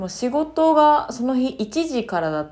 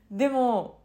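ordinary talk

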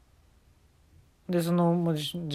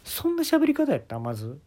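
high squeaky incredulity; mock incredulous; 11 semitones higher than usual. Real, not acted. The comedian mocking Jacob's manner of speaking, squeaky disbelief.

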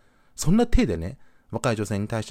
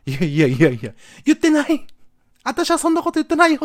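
loud, very high mock protest; exaggerated inner objection; frantic denial; 7 dB louder than usual. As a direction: deny it loudly, voice shooting up. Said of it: "って思ってさ".

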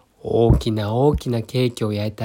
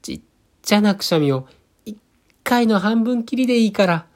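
high, fast, tiny pleading voice; pleading whisper; 9 semitones higher than usual. A narrator's reading. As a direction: squeak it out small. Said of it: "耳元でささやきました".

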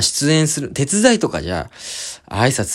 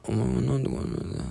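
soft mumbled grumble, much softer; mumbling; 10 dB quieter than usual. Retold or imitated. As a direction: mumble it under your breath. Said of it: "ボソボソ言っててな". (ぼそぼそと小声でつぶやく)

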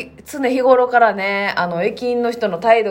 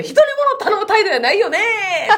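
a raised, pitched-up jab for all to hear; loud pointed remark; 10 semitones higher than usual. Retold or imitated. Friend retelling her own loud dig at the old man, mock loud. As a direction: call it out higher so the rude man hears. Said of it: "結構でかい声で".

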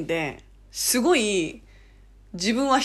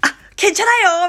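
high, loud, quick comic exclamation; comedian's catchphrase; 10 semitones higher, 7 dB louder, much faster than usual. Retold or imitated. Imitating comedians doing Korean, hammed-up exclamation. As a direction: fire it off like a comedian's gag. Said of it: "いろんな芸人さんとかがよくやってる".

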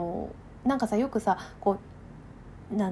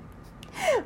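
high laugh. Real, not acted. Laughs at her own overstatement.

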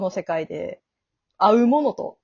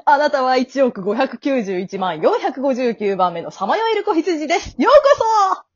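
high, loud fanfare welcome; cheery grim reaper guide; 7 semitones higher, 6 dB louder than usual. Acted. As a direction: announce it like a jubilant prize presenter. Radio drama death guide's greeting.

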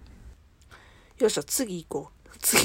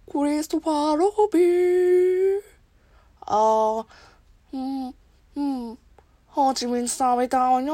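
slow, higher dreamy bear voice; Winnie the Pooh impression; 4 semitones higher, slower than usual. Streamer imitating Pooh for viewers, slow and high. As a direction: drawl it slowly like a sleepy bear. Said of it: "熊野プーさん".

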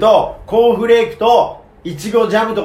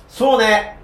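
usual speaking voice

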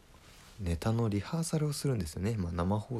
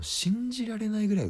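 much higher and louder in disbelief; amazed emphasis; 7 dB louder than usual. Real, not acted. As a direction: stretch it out, truly astonished. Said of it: "emphatic astonishment".

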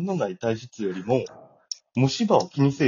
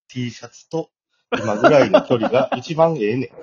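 louder, declaimed like a famous saying; grand famous quote; 5 semitones higher, 7 dB louder than usual. Shown as a demonstration. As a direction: proclaim it like a legendary quote. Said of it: "もう、この言葉". (名言風に声を張って言い切る)

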